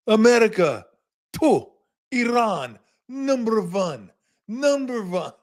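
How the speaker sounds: high, hammed-up Iron Sheik chant with a foreign accent; mock Iron Sheik taunt; wrestling promo voice. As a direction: bellow it like a heel wrestler's promo. Wrestling announcer/Iron Sheik impression.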